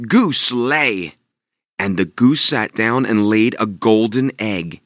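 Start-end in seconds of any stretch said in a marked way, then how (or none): 0.1-1.2 Jack's command. Acted. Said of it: "he commanded".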